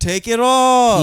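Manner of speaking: high, loud, slow drawn-out heckle; hammed-up heckler chant; mock crowd yell. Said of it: "they kept yelling".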